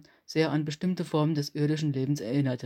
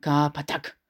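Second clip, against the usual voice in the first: hoarse alien swearing; alien curse; 3 dB louder than usual. A narrator's reading.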